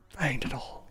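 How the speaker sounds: hushed, voiceless aside; conspiratorial whisper. Pretend. Streamer telling viewers who is behind everything, whispering. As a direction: whisper it like a secret. Hushed echo of his own claim.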